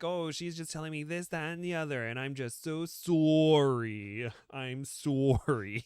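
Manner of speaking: high Canadian rant ending in long sorries; mock Canadian accent. Imitating a ranting reality TV contestant, mock Canadian accent. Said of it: "she's just like".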